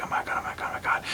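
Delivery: voiceless, breathy panic, very soft; terrified whisper. Retold or imitated. Heroine realizing the drink knocks them out, whispering. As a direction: whisper it in sudden dread.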